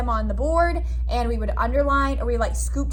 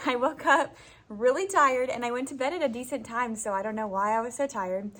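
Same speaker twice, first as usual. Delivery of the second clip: usual speaking voice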